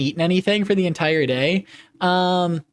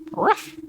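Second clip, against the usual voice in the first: puppy bark; 12 semitones higher than usual. Pretend. Puppy streamer barking.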